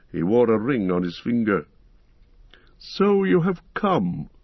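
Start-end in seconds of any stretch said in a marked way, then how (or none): 2.8-4.3 slow, grave wizard drawing out the first word high; a lightly voiced stern Saruman greeting an old rival. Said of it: "he said to me gravely".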